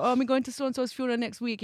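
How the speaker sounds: a hint of a Jamaican accent, quoting her dad. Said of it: "the Jamaican accent".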